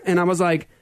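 normal talking voice

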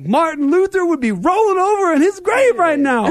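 high, loud impression of the son laughing at it; son's voice. Son in the back seat quips to dad, high and loud. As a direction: play the kid cracking up from the back seat. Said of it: "He's like".

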